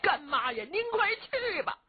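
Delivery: pleading, rising high into a wail; crying plea. A narrator's reading. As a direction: beg godmother through tears. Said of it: "见了老夫人就哭啊".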